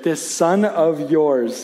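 slow, distancing drawl of disdain; cold disowning tone. Retold or imitated. Older brother disowning his sibling to Dad, slow and cold. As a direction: say it like the boy isn't yours. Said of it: "he says".

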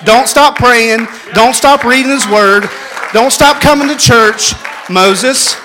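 loud rising preacher's exhortation; loud, high sermon climax; full-force preaching. Acted. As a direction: build the fire, preach it out to the pews.

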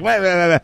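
high mock whining wah wah. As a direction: canvasser droning on, mock wah wah. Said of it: "he's like".

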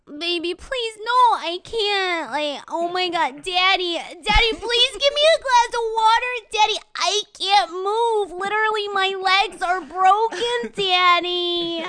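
put-on whiny baby voice. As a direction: beg like a helpless pouting toddler. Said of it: "I, I'm always like".

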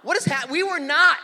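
high, loud retold panic; mock-panicked outburst; freaking out. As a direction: act out alarmed protest, voice jumping up. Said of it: "So I was freaking out".

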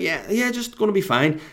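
high-pitched, harried reassurance; stressed brush-off. Retold or imitated. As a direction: brush the caller off in a high, strained voice. His stressed reply to Shane.

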